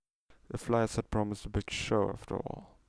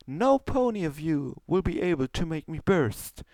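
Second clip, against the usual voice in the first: much higher, louder boastful stage voice; grand showmare announcement; 9 semitones higher, 3 dB louder than usual. Acted. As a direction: proclaim like a vain stage magician. Trixie presents the pump trick.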